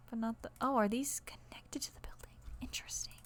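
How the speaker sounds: puzzled whisper to yourself. Real, not acted. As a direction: whisper it as you notice something odd. Noticing something while building.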